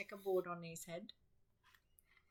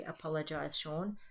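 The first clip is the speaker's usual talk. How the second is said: plain reading voice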